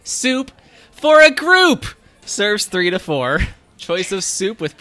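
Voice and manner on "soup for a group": excited exclaim. Acted. Excited menu item exclaim.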